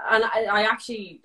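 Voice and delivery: ordinary talk, nothing marked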